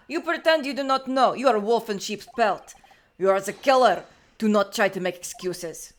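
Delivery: put-on loud, hammering Russian-accented rebuke; angry Russian accusation. Russian character angrily accuses prisoner.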